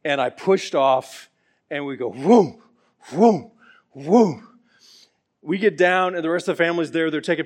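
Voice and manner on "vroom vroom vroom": loud, playful vroom going down the slide. Retold or imitated. Uncle voicing the ride down the slide, playful loud vroom. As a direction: make the slide noise big for the kids.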